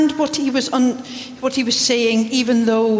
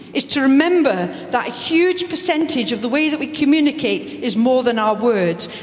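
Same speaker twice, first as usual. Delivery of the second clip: normal talking voice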